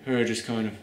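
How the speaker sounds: a hint of a drained mumble; flat monotone. Shown as a demonstration. Reviewer keeps mimicking the actress's low, flat murmur. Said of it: "talk like this".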